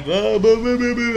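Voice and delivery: high, loud mock blubbering; imitated crying. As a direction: babble high as if sobbing, in imitation. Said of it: "And I'm crying".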